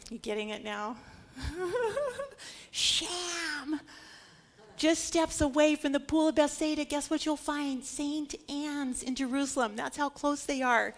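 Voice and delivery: normal talking voice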